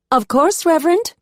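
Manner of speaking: high, loud Southern Belle eagerness; volunteer like an eager Southern Belle, pitched high; Southern Belle accent. A narrator's reading. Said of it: "in her best Southern Belle accent".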